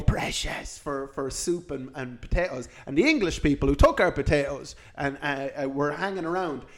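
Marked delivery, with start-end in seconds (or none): 0.0-2.7 unvoiced, hissing Gollum whisper; whispered Gollum "precious".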